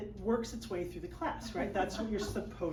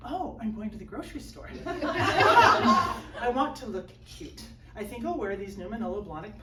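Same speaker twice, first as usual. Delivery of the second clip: mock-posh shopper primping; posh snooty shopper; 5 dB louder than usual. Shown as a demonstration. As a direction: put on a prim rich-lady voice. Acting out a posh Bellevue woman.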